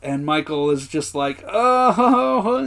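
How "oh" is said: high overwrought moan; mock worked up. Michael reacting to his last-day lunch offer, mock overwrought. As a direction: imitate someone getting choked up. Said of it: "he's, like, all worked up".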